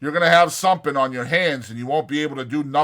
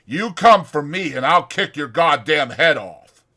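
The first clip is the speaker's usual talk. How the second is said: loud, sneering and higher; contemptuous threat; 6 semitones higher, 9 dB louder than usual. Acted. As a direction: taunt him as a coward. Carlson threatens Curly.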